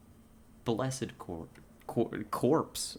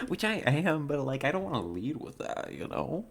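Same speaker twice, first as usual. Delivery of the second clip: put-on higher, swooping Valley Girl lilt; chatty Valley Girl with rising phrase ends; 5 semitones higher than usual. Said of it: "You want the Valley Girl voice?".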